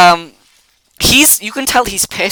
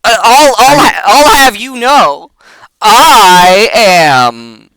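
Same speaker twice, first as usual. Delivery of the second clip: a self-important goblin, raised and louder; pompous goblin; 3 semitones higher, 5 dB louder than usual. Acted. Goblin held aloft, pompously indignant and louder. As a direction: puff up like an offended little noble.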